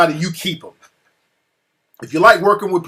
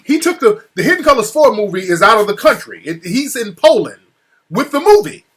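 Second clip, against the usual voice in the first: much higher and louder, building outrage; indignant rant; 5 semitones higher, 5 dB louder than usual. Real, not acted. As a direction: hammer home that he took it abroad. Ranting about film taken abroad.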